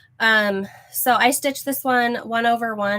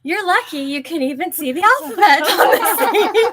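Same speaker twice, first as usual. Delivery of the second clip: laughing; 8 semitones higher, 6 dB louder than usual. Real, not acted. Laughing at her shrunken pillow.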